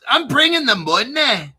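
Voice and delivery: mock British accent. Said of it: "there's people that talk like this".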